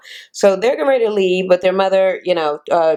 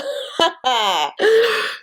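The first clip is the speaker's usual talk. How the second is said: high laugh; 11 semitones higher than usual. Real, not acted. Laughing at the restraining order.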